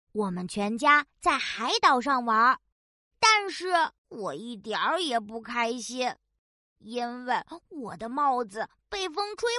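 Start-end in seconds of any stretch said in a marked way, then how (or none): none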